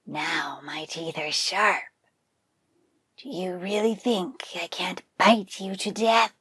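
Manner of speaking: slow, whispered threatening tiger; tiger's menacing reply. Acted. Tiger answering the buffalo's boast, slow menacing whisper. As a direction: threaten softly like a patient predator.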